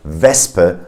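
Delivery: slow WESPE as "VESS-pe"; plain S, not sh. Shown as a demonstration. Teacher confirms the exception, s not sh. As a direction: keep a clear s in WESPE. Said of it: "you have a S sound".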